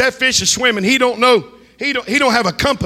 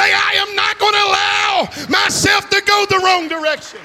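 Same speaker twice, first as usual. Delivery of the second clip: rising, shouted sermon climax, higher and building; preaching at full force; 5 semitones higher than usual. Acted. Preacher warning runaways from their calling, full-force preaching. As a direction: drive it home to the congregation, climbing.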